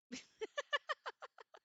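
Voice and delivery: high giggle; real giggle; delighted giggle. Real, not acted. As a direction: giggle at the chickens. Laughs at chickens.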